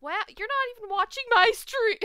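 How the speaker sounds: high, loud mock outrage; playfully scandalized shout; loud teasing protest. Real, not acted. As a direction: call them out, loud and high.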